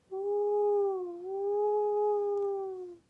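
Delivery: high, loud, hooting ghost wail; ghostly woo. Acted. Narrator wails the ghost train's whistle, high and hooting.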